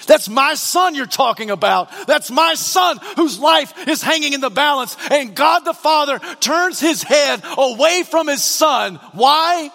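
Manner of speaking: high, forceful protest from the pulpit; impassioned preaching. Real, not acted. Preacher protesting for a son whose life hangs, impassioned shout.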